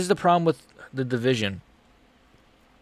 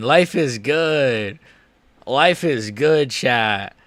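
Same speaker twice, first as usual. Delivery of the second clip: slow savoring; much slower than usual. Real, not acted. Savoring the win.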